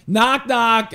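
put-on high, loud kid voice; a kid starting his joke; proud kid. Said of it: "He's like".

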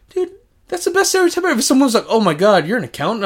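excited. Real, not acted. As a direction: rush it out, thrilled. Excited rant, fast and high.

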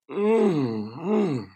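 mock food moans, hummed Mm mm; imitated moans of pleasure. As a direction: hum Mm, mm like savouring a delicious bite. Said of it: "like, moaning with pleasure about how good this food".

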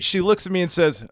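ordinary talk, nothing marked

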